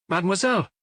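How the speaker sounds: clipped high correction; prim high correction. A narrator's reading. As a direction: snap the title back, offended. Sharp correction of title.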